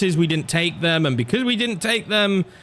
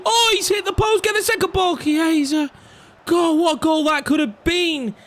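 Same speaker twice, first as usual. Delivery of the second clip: excited; 12 semitones higher than usual. Real, not acted. Player groaning at his team's near miss, much higher. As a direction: leap up as the ball smacks the post.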